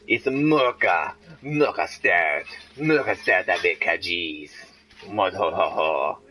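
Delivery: low, slow fake French purr; mock French accent. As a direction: roll it out like a hammy French waiter. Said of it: "make everything sound sexy in French".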